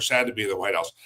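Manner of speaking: low, dropped, mildly downcast delivery; sad. Shown as a demonstration. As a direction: let the voice sink as if dejected. Contrasts happy and sad visitors.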